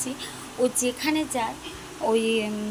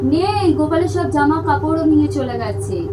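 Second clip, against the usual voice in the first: higher, louder pretend news; teasing, raised voice to the child; mock dismay; 5 semitones higher, 12 dB louder than usual. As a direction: play it as sad news. Pretending the doll is gone.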